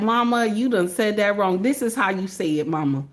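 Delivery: low, soft imitation of a correcting child; lightly voiced kid setting mama straight, pitch sinking. Mother imitating her kids correcting her speech, low and quiet. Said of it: "even the kids get in there and be like".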